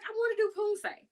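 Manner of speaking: put-on high, eager little-kid plea; a kid pleading to do forms, high and eager; childlike eager voice. Voicing younger self.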